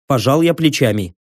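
ordinary delivery, nothing marked

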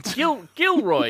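high, loud cry of the name; blurted exclamation, jumping up in pitch; exclaiming. Acted. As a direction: call out in sudden recognition. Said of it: "you exclaim".

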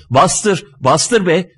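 a lightly shouted order; mild shout. A narrator's reading. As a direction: call out impatiently, without full force. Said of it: "diye bağırıyor".

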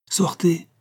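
a servant urging them out the door; cried out. A narrator's reading. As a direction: call her out, quick and high. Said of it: "s'écria Martine".

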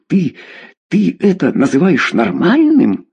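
high, halting outrage; appalled indignation. Acted. Mother appalled that daughter calls basement normal, outraged. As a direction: stumble on the first word, then climb indignantly.

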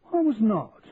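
high, indignant denial; protesting. A narrator's reading. Charlie denying that he cried at the ceremony, indignant protest. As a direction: jump in with a quick protest. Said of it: "protested her husband".

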